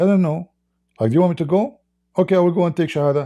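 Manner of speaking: mimicking the woman. Retold woman's replies on Skype.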